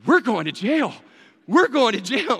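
loud, high-pitched mock wail; hammed-up panicked cry; wide-eyed panic. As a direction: imitate a friend freaking out completely. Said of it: "had that wide-eyed panic".

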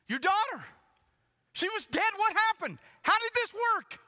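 high, very loud disbelieving questions; astonished shouting. Acted. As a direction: fire questions like a stunned crowd. Townspeople shout questions at dad.

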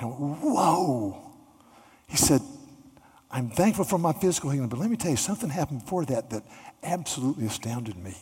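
the healed paralytic, overcome with joy; healed man overjoyed. Acted. Healed man tells his friends.

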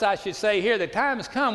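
ordinary talk

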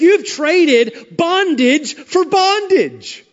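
slow, high, emphatic preacher's declaration; preaching. Acted. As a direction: hammer the line home slowly from the pulpit.

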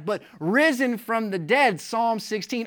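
ordinary talk

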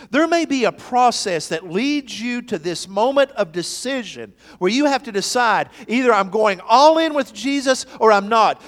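loud, high, driving sermon voice; fervent pulpit delivery; full-force preaching. Acted. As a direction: preach it out to the back pew. Preaching on decision.

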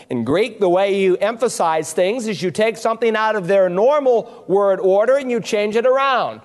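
normal talking voice

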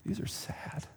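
whispered under the breath; a quiet private comment; whispered aside. Real, not acted. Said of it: "whispered aside".

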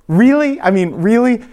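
high-pitched incredulous questioning; mock disbelief. Reacting to the cheering street people, mock disbelief. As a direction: squeak it out, comically incredulous.

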